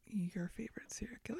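hushed, breathy build-up whisper. Acted. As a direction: whisper it like a secret. Setting up the big reveal.

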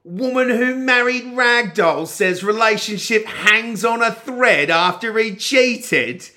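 high, loud, slow headline read; news anchor voice. Acted. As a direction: read the headline with mock gravity. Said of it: "What was that?".

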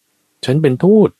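high, loud cry to the crowd; calling out. Acted. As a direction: raise the voice and proclaim it. Beggar announces himself to crowd. (ตะโกนประกาศเสียงสูงดัง)

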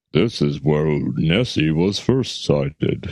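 low, slow announcer narration; recorded tour voice. Pretend. Voicing the button recording in the Loch Ness diorama. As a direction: play the diorama's recorded guide.

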